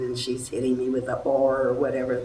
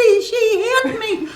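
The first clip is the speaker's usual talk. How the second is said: much higher, louder, slower tearful wail; retold sobbing complaint; 6 dB louder than usual. Struck actress wails to the director, imitated tearful cry. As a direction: whimper it out like a hurt, crying girl. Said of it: "she's tears bawling like crazy".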